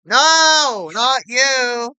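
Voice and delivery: high yelling no at the screen; shouted protest. Real, not acted. Kid yelling at the game for the wrong pick. Unwanted character appears in game.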